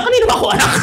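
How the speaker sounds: very high mock child's question; squeaky mock whine; confused child. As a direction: ask it like a lost kid.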